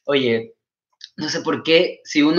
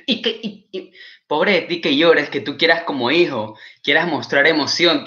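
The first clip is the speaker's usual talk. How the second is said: normal talking voice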